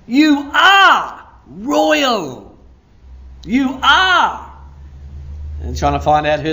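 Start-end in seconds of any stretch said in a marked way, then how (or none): 0.1-2.6 high, loud and drawn out; emphatic proclamation. Real, not acted. Preacher tells congregation they are royal, emphatic proclamation. 3.5-4.5 high, loud and drawn out; emphatic proclamation. Real, not acted. Preacher tells congregation they are royal, emphatic proclamation.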